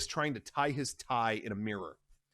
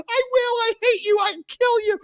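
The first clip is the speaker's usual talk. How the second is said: much higher, loud; screaming threat; 9 dB louder than usual. Acted. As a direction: scream it at him.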